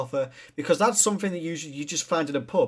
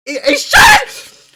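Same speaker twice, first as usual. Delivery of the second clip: a high laughing outburst, held long; laughing shout; 14 dB louder, much slower than usual. Real, not acted. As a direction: burst out laughing at the sight. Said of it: "laughing outburst at his shirt".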